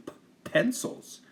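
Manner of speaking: usual speaking voice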